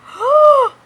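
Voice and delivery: loud, high, theatrical gasp; imitated shocked gasp. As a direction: gasp in mock disbelief. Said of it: "my face is like".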